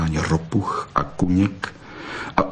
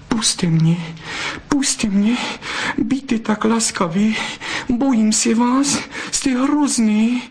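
high, pleading vixen voice, rising in fear; frightened pleading; 13 semitones higher, slower than usual. Acted. Vixen begs the fox to let her go, high and frightened. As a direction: beg him to let go, scared and breathless.

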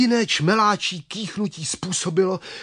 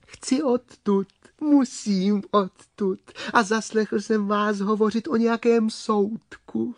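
small high voice with a smile; high-pitched storybook worm; smiling little worm; 6 semitones higher than usual. Acted. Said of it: "Červík se usmál".

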